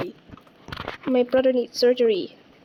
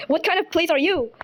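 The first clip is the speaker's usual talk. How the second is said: ordinary talk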